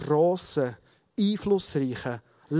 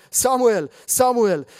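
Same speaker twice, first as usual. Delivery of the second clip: raised, louder call of a name; 5 semitones higher, 5 dB louder, slower than usual. A narrator's reading. The Lord comes and calls Samuel as before, calling out. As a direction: summon by name, twice, falling away. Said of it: "rief wie vorher".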